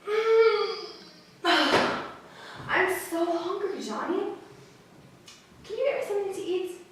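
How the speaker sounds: high small voice, weary with hunger; hungry little sister. Acted. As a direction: whine it like a hungry little girl just waking.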